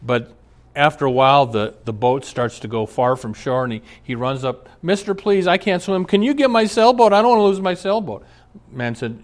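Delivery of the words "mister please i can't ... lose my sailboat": put-on high, fast child's plea; small boy begging a stranger for help; pleading little boy. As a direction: beg like a scared kid.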